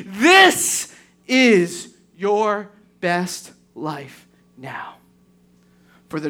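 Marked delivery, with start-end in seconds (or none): 0.1-5.0 very slow, heavy, each word stretched; drawn-out verdict. Retold or imitated. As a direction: let it sink in slowly, like a final judgment. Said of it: "he's looking at this and saying".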